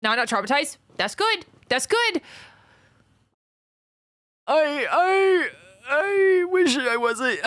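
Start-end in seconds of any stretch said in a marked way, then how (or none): 1.2-2.2 high cheer. Real, not acted. Cheering a viewer's news. 4.5-7.4 loud outburst. Real, not acted. Blurting a sudden loud reaction.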